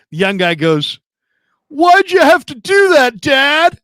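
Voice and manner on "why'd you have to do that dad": high, loud, imitated complaint; retold indignant cry, pitched way up; imitated protesting son. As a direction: wail it at your dad. Young fighter's protest, retold.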